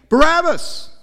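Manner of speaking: loud, high chant of the name; crowd shout. Acted. Crowd shouting to Pilate for Barabbas, loud high shout.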